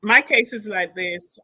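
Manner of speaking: normal talking voice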